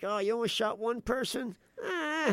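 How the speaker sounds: high-pitched mock shrug-off; dismissive judge. Imitating county judges used to shootings, dismissive. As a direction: play a judge waving it away. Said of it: "and they're like".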